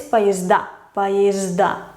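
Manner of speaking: "поезда" with a clearly voiced zd; voiced cluster. Shown as a demonstration. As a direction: buzz the zd in "поезда". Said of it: "se pronuncian como zd".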